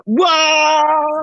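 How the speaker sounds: long, high-pitched whoa; hyped-up cheer held long and high. Real, not acted. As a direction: let out a drawn-out, high whoa. Hyped reaction to his introduction.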